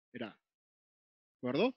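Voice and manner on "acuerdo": ordinary delivery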